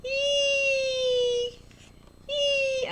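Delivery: very high squealing "Eek"; shrill mock fright squeal; excited squeal. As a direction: squeal like you just saw a snake. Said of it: "could you tell how excited I was".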